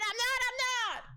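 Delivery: escalating mock protest, ending high; building high squeal. Shown as a demonstration. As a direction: climb up into a playful high squeal. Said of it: "repeats phrase rising each time".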